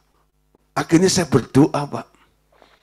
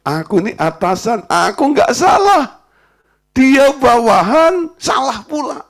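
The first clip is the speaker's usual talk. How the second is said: higher, slow, self-righteous boss; proud boss; 8 semitones higher than usual. Retold or imitated. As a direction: drag it out like a smug superior.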